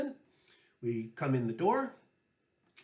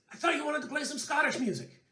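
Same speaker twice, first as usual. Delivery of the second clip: much higher, mock-innocent jab; teasing Paddy; 12 semitones higher, faster than usual. Paddy needling Johnny after ten minutes of tunes, mock-innocent. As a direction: feign surprise, pitched way up. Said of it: "and says".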